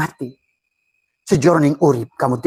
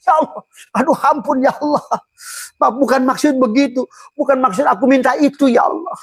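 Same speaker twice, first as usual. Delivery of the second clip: pleading prayer; 11 semitones higher than usual. Retold or imitated. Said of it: "diijabah malah nangis".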